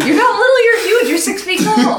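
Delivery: high-pitched amused objection; laughing protest. Real, not acted. Player objects that Muggsy is huge, laughing protest.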